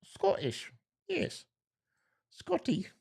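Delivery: high-pitched attempt at Scottish; mock Scottish accent. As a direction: play with a squeaky Scottish accent. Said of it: "Someone said it's Scottish".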